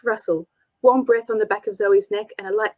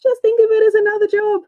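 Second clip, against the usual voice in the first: higher, louder pep talk to herself; Zoe's bold self-pep-talk; Zoe psyching herself up; 12 semitones higher, 6 dB louder than usual. Acted. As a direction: talk yourself into it, loud and high. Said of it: "she told herself".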